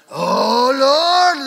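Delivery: loud, high mock prayer wail; carried-away praying. Ladies laying hands on a boy, loud carried-away praying. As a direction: cry out like an over-fervent prayer warrior. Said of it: "begin to really get carried away".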